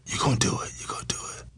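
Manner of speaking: imitated hushed, voiceless whisper; barely audible whisper; shocked whisper. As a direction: whisper it as a stunned aside. Wife's shocked whispered aside.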